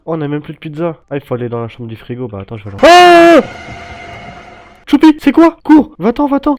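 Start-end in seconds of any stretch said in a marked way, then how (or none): 2.8-6.5 shrieking in panic; high frightened yelling; panicked scream. Real, not acted. Jump scare in fridge.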